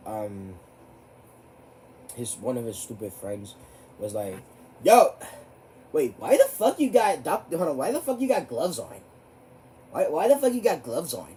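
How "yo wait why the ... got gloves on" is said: loud, high taunting question; loud high imitation of a teasing classmate; mocking callout. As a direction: jeer at his gloves. Quotes friend calling him out.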